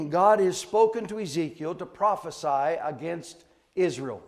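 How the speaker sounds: usual speaking voice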